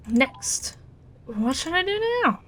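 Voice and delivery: ordinary talk, nothing marked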